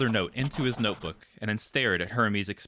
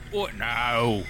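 moaning; 2 semitones higher, 4 dB louder than usual. A narrator's reading. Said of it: "Hermes moaned".

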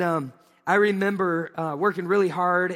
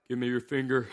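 stern nurse; 6 semitones lower, 3 dB quieter than usual. Retold or imitated. Voicing the big nurse.

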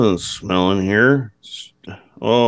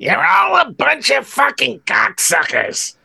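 Gilbert Gottfried; 14 semitones higher than usual. Pretend. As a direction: scream like a cranky comic. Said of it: "with the voice of Gilbert Gottfried".

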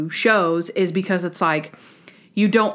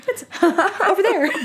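laughing imitation; 11 semitones higher than usual. Imitating Natalie's pointing remark.

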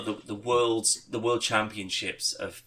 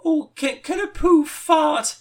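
very high, slow, timid little squeak; squeaky peasant voice; 6 dB louder, slower than usual. Retold or imitated. Peasant asking the king a silly question, very high and squeaky. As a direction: ask it like a shy tiny peasant. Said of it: "he's like".

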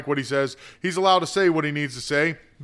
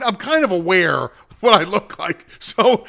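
ordinary talk

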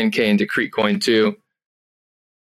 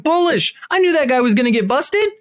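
smug excited; 13 semitones higher than usual. Retold or imitated. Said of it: "I'm like".

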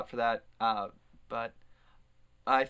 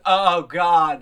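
loud, higher mock groan of annoyance; [name], loud exasperation; 6 semitones higher, 16 dB louder than usual. Friend fed up with all the greetings, real loud and exasperated. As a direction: blurt it out so the person hears. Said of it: "like, real loud in front of the person".

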